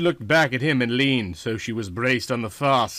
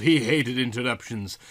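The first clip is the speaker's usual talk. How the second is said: usual speaking voice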